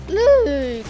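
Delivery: high, loud falling cry; loud outcry. Real, not acted. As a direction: yell it out as the game slips away. Calling out while losing the game.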